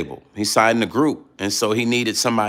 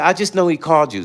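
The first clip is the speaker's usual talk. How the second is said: lightly voiced kid, higher and quick; little cousin; 7 semitones higher, faster than usual. Kid cousin on the phone, quick higher voice. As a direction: toss it off like a kid. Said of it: "you know he's a kid".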